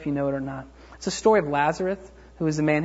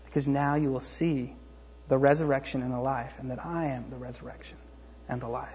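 low, hushed declaration; acted quiet authority, dropping low; gentle solemn assurance; 4 semitones lower, 8 dB quieter than usual. Voicing Jesus answering grieving Martha gently.

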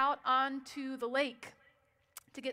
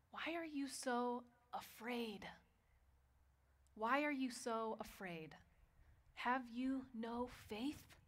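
gentle rebuke; 7 dB quieter than usual. Acted. Jesus gently asking his frightened disciples, soft and slow. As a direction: ask them tenderly why they fear.

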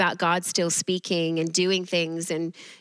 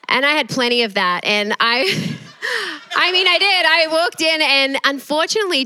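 loud laughing; 8 semitones higher, 5 dB louder than usual. Real, not acted. Laughing confession about being religious.

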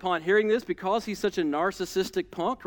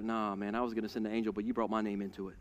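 God, calm low; 9 semitones lower, 8 dB quieter, faster than usual. Retold or imitated. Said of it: "he's like".

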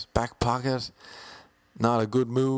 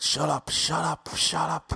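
higher, slow, hammed-up shut up; mock cop snapping; 7 semitones higher, slower than usual. Police officer silencing the handcuffed man, slow higher mock snap. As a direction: snap it at him like an irritated cop.

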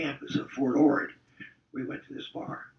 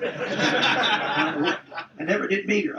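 laughing; 7 semitones higher, 5 dB louder, much slower than usual. Real, not acted. Laughing remembering being kept away.

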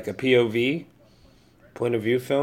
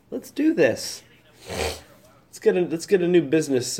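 mock hype; 6 semitones higher than usual. Host pitching entrepreneurs, mock hyped-up rally. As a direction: pump it up like a pitch. Hyping a podcast business.

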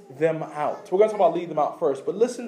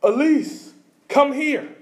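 put-on higher call across a crowd to a child; 6 semitones higher, much slower than usual. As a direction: call your daughter's name over the crowd. Parent calling lost kid in crowd.

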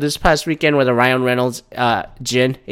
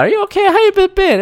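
much higher, sugary mock-caring voice; mock sympathetic friend. Spoofing the gin ad's friend checking on the wife, much higher mock coo. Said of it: "this ad is like".

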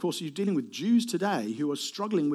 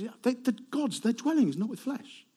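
higher-pitched acted dismissal; voiced adviser's reply; 7 semitones higher than usual. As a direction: lightly voice an adviser explaining the obvious. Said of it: "But he says".

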